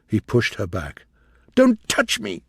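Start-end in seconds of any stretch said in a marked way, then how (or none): 1.5-2.4 sharp high cry of rejection; cried out. Acted. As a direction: push her away with the voice. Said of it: "he cried".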